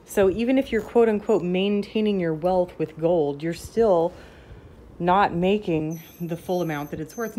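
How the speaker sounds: ordinary talk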